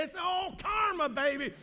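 high, loud mock of a flippant worldly person. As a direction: sneer out the world's cheap wisdom. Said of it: "what the uninformed world is gonna say".

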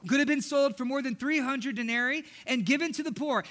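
ordinary talk, nothing marked

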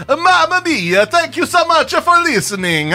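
high, singsong hammed-up Italian; bad Italian accent. Shown as a demonstration. Host thanks listeners on co-host's dare, bad Italian accent. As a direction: ham up a cartoon Italian accent. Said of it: "Can you do a bad Italian accent?".

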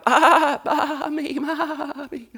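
high, whiny lamb calling mommy; whimpering lamb. Acted. Little lamb calling for its mother, high whimper. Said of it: "the little lamb is going".

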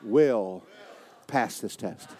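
slow, soft, deliberate affirmation; call-and-response declaration. Shown as a demonstration. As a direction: lead them in a spoken vow.

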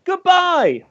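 high sing-song goodbye. Pretend. Host signs off the podcast, sing-song.

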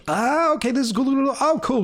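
high, excited realization; light up in a high voice as it clicks; delighted discovery. Retold or imitated. Acts out delighted discovery of new line.

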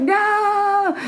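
high, drawn-out mock wail; protesting wail. Woman refusing to return to her body, high wailing protest. Retold refusal to come back.

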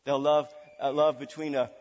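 usual speaking voice

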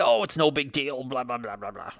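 mock dismissive. Dad shrugs off his son's worry, mock dismissive. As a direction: wave it away, letting the blahs fade out.

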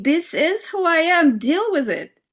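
high, loud, defiant; defiant declaration. Pretend. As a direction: announce it proudly, no apologies. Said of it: "and saying".

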